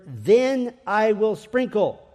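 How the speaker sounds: "Then" drawn out slow and loud; stress on THEN. Shown as a demonstration. Preacher slowing to underline "Then I will sprinkle". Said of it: "this word".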